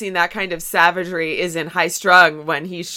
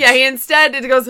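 ordinary delivery

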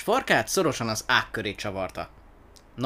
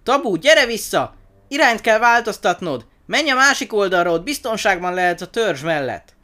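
high, loud, urgent call; agitated shouting, pitched well up; shouted warning; 9 semitones higher, 9 dB louder than usual. A narrator's reading. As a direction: yell them back to safety. Giraffe shouts warning to endangered monkey.

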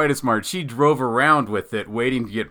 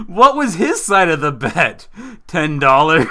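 raised, loud incredulous questioning; laughing disbelief; 6 semitones higher, 4 dB louder than usual. Retold or imitated. Husband asking wife about the bet, laughing disbelief. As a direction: ask it through incredulous laughter.

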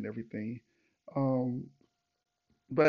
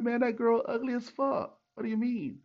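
pitched way up in disbelief; incredulous; 10 semitones higher than usual. Retold or imitated. Friend to homeboy about the City Girl, high incredulous. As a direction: react like he's lost his mind.

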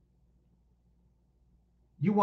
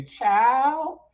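high, rising drawn-out imitation; mock church lady; 9 semitones higher than usual. Commentator quoting the woman's pet word, high mocking imitation. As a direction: mimic her pet word, pitched way up. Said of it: "every time you say".

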